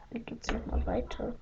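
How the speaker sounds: very soft, almost voiceless murmur; quiet aside. Real, not acted. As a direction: mumble it to yourself, having lost the thread. Forgot the joke, asks aside. (leise vor sich hin gemurmelt)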